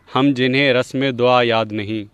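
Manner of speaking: ordinary delivery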